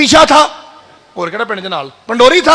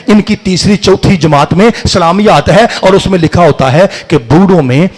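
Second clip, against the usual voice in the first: ordinary talk, nothing marked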